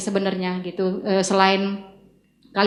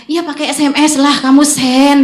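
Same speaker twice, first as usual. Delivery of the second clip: louder, higher, impatient push; insistent order; 5 semitones higher, 7 dB louder than usual. Retold or imitated. Mentor urging her to send the text, loud insistence. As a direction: press the order home loudly. Acted insistence of Ibu Eli.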